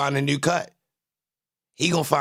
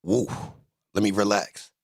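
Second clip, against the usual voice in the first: ordinary delivery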